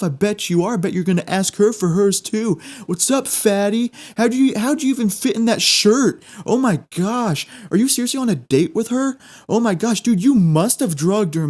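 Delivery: high sneering taunts; mocking heckler voice. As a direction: jeer at him like a bully. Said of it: "they're like".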